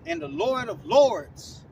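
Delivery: slow, loud proclamation; proclaiming. Real, not acted. Preacher proclaiming the title to listeners, loud and slow. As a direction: ring it out to the crowd.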